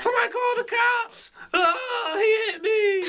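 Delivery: high, hammed-up crying plea; mock wailing victim. Mocking her crying that he hit her, high wail.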